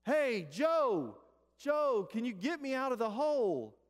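high, loud yell for rescue; drawn-out, high-pitched shout for help; shouting to a friend. Retold or imitated. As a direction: holler up from the bottom of a hole. Trapped man shouts to friend.

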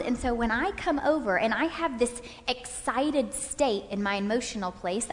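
ordinary talk, nothing marked

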